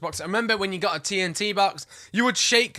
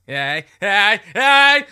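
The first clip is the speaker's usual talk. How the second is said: playful cheering; 3 semitones higher, 12 dB louder, much slower than usual. Pretend. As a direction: build up to a shouted mock cheer. Playful cheer for the pink-haired character.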